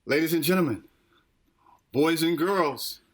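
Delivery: high, drawn-out showman intro; cartoon intro announcer.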